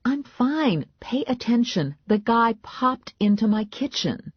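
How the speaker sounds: high-pitched exasperation; exasperated insistence. A narrator's reading. Heroine argues to be believed.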